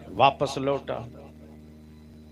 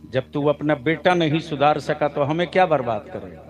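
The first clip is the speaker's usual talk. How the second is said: ordinary delivery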